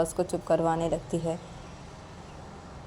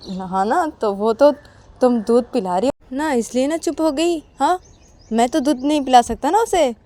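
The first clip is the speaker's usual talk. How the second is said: grudging husband; 6 semitones higher, 9 dB louder than usual. Acted. Akash grudging excuse.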